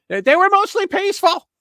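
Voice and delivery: very high, loud mocking squeal; mocking parrot voice. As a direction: mimic them in a squeaky mock voice. Mocking the media line on riots.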